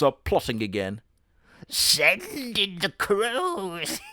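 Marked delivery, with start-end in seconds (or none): 1.7-4.0 much higher, very slow villain voice; scheming villain. Acted. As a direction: give the order with slow menace. Villain weasel orders attack.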